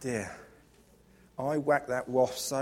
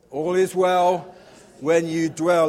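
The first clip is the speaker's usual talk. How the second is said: proclaimed slogan; 2 semitones higher, 8 dB louder, slower than usual. Acted.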